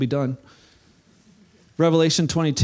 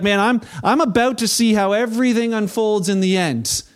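higher, louder, eager rush; excited anticipation; 6 semitones higher, 4 dB louder than usual. Acted. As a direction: sound thrilled to see it all. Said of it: "John's like".

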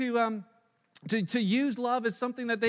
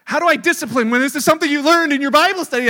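exasperated; 4 semitones higher, 12 dB louder, faster than usual. Real, not acted. Exasperated over discipline dilemma.

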